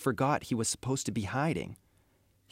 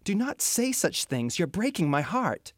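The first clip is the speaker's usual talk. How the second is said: higher pleading voice; begging; 8 semitones higher than usual. A narrator's reading. The queen begs her son to stop, higher pleading. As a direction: plead with him, pitch lifted. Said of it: "the queen begged".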